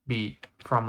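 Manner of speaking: ordinary talk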